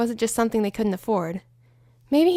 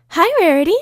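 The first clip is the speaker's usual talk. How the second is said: high, loud cheery greeting; high loud chirpy hello; bubbly Pinkie; 7 semitones higher, 7 dB louder than usual. Acted. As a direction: bounce in with a hello.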